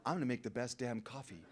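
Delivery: quiet vow. Retold or imitated. Retold sudden resolve.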